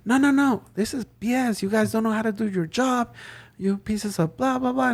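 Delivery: hammed-up caller yelling at staff; mock angry cussing. Caller cussing out the manager, mock angry rant. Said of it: "Started cussing me out".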